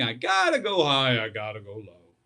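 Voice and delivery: sing-song, high then low. Shown as a demonstration. Coach showing pitch matching, high then dropping low. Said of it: "matching the song".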